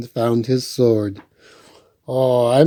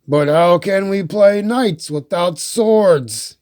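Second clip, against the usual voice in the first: disappointed Bear; 5 semitones higher than usual. Acted. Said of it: "Bear was disappointed".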